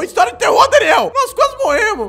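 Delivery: loud high outraged yell; upset shouting. Real, not acted. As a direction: shout it, still shaken. Yelling at Daniel after scare.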